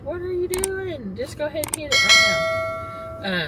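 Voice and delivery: high, slow mock disbelief; mock exasperated. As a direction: scold the viewer playfully, drawn out. Urging viewers to subscribe.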